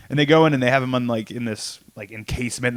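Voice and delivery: ordinary delivery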